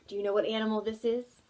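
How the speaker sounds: ordinary delivery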